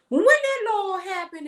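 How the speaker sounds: imitating mom snapping back in disbelief; mom's indignant retort. Mom demanding of the officer, high and incredulous.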